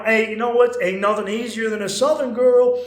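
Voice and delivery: loud, cheeky crowd-baiting. Retold or imitated. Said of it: "he's playing the piano".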